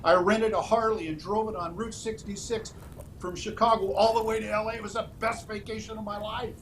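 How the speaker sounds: quoting him with a big smile in the voice; beaming retold boast; grinning retelling. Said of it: "he broke into this great big smile".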